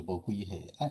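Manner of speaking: ordinary talk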